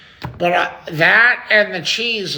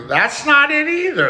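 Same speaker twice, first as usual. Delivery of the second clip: exasperated; 10 semitones higher than usual. Pretend. Another failed attempt.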